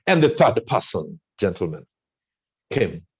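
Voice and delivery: usual speaking voice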